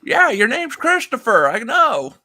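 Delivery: put-on loud, high cranky old man. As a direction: grumble back like an old man. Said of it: "this old man thing here".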